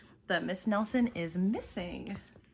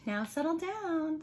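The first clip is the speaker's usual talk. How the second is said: high, sweet teacher's coaxing; sweet teacher asking class to settle; 9 semitones higher than usual. Acted. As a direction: coax them gently, sweetly. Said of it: "in a sweet voice".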